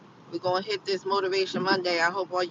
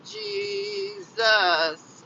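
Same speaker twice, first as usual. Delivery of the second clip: high exclamation; 12 semitones higher than usual. Real, not acted. Exclaiming at the cold.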